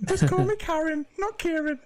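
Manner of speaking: mock post-operation squeak; put-on squeaky Geordie voice. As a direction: play a silly high-pitched Geordie character. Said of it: "You sound more Geordie when you do that voice".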